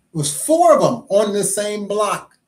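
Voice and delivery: high, loud punch on "four"; stress on "four". Real, not acted. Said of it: "Emphasis on the word four".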